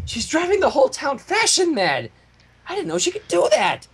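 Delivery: high, aghast cry of disbelief. Acted. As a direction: gasp it out in shock. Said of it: "Pinkie said aghast".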